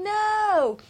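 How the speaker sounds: high loud cry of No; a retold scream of refusal; reenacted scream. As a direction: cry out No in anguish. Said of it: "And I'm screaming".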